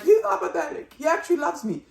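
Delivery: high, loud indignant defence; defending him. Retold or imitated. Wife defends her husband to the crowd, high and loud. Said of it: "when she was like".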